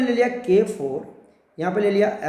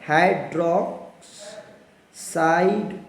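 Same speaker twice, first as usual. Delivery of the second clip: slow spelling-out; 3 semitones lower, much slower than usual. Shown as a demonstration. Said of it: "देखो कैसे लिखते हैं".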